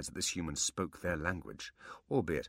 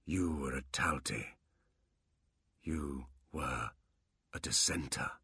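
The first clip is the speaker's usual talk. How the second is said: slow, quiet whisper; 4 semitones lower, 6 dB quieter, slower than usual. Acted. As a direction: recall it in a hush. Quan remembers slowly.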